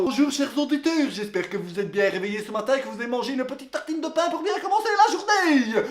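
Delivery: sing-song host patter to the listeners; model radio host. Shown as a demonstration. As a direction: show off a cheery breakfast-show opener. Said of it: "moi te montrer comment on fait".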